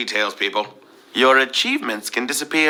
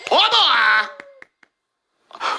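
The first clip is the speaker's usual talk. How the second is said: excited shout; 12 semitones higher than usual. Real, not acted. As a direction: cheer the winners on. Celebrating the winners.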